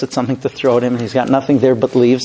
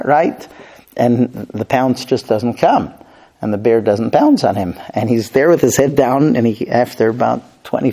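normal talking voice

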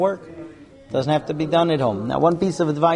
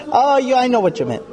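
offended wife; 8 semitones higher than usual. Pretend. Wife dismissing husband.

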